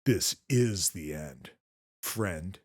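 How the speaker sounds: low, slow and deadpan; a lightly voiced action-hero line, dead calm; cool calm one-liner. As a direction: kid delivering the final line to Chucky, low and slow. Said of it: "Andy just super calm".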